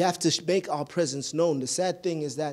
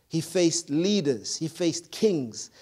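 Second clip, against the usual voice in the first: slow, weighty list; slower than usual. Acted. Building list of what Jesus faced.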